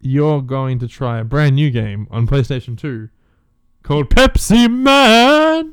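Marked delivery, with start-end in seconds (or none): 3.8-5.7 high, loud, slow ad cry; Pepsi Man jingle. Acted. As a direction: sing it out like a soda ad.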